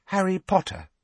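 put-on high, hesitant girl's voice; tentative, with small pauses. A narrator's reading. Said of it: "said Hermione tentatively".

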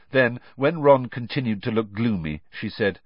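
plain reading voice

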